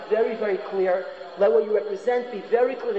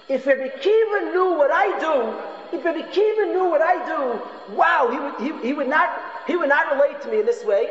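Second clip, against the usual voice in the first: higher, slow, awed student musing to himself; student's inner thought; 7 semitones higher than usual. Acted. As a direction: voice the wrongdoer's amazed inner thought, raised and drawn out. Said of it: "the person would say to himself".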